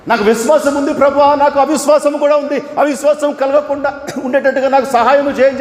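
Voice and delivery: high, loud desperate cry of a father; a father crying out to the Lord for help. Acted. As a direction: plead high and loud, near tears. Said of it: "చెప్పి ఏడుస్తూ బిగ్గరగా చెప్పాడు".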